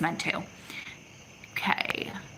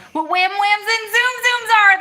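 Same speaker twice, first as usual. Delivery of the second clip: high, loud sing-song squeal; playful, squealed quote far above usual voice; gleeful quoting; 12 semitones higher, 9 dB louder than usual. Retold or imitated. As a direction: chant the silly words with rising glee. Reads husband's playful comment, delighted.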